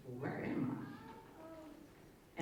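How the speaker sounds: very slow, high and faint, lost; a faint, drawn-out, bewildered ask; dazed question. Retold or imitated. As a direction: drift through it as if just waking. Dazed after the car crash.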